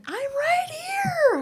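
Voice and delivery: put-on very high, slow kid's call; imitated child. Son answering his mother across the park, mock high holler. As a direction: squeal it back like a faraway child, stretched out. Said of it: "And then".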